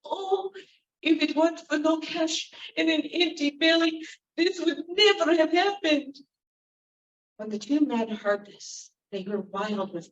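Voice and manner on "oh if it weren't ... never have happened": put-on high, lamenting old woman. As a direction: groan out the lament. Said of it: "continued to moan and groan".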